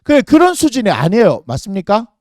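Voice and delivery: ordinary delivery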